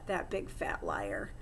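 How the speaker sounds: hushed whisper so the cat can't hear. Acted. Hamster hiding under the sofa from the cat, whispering. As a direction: breathe it out, barely voiced.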